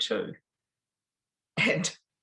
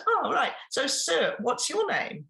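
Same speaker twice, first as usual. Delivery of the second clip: higher, bright showman turning to the husband; lightly raised, eager host voice; chirpy seminar host; 9 semitones higher than usual. Retold or imitated. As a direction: play the eager host working the front row. Speaker turns to the husband.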